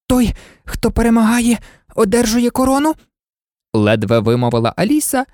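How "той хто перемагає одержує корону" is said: high, puffed-out question; out of breath. A narrator's reading. Alice asks the king while running, out of breath. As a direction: barely get the words out from running. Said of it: "від бігу в неї перехоплювало подих".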